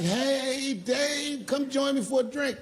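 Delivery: high-pitched, friendly feminine imitation; Daphne. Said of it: "And she's like".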